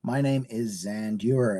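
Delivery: usual speaking voice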